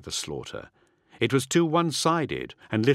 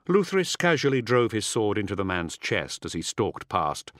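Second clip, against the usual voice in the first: usual speaking voice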